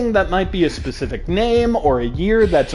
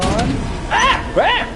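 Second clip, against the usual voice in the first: two short high yelps; startled yelps; 5 semitones higher than usual. Real, not acted. Streamer checking if the lemur is gone, startled yelps. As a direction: yelp twice, the second higher.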